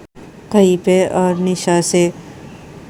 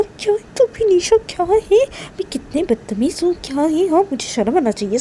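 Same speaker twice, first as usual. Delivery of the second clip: higher, pushy self-scolding, answering herself with हाँ?; Nisha scolding herself; 5 semitones higher than usual. Acted. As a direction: nag yourself like a guilty girl.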